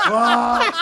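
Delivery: high, loud, incredulous yelp; mock disbelief. Actor sure he is getting fired, hammed-up disbelief. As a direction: blurt it out in exaggerated shock. Acts out panic at nearly being fired.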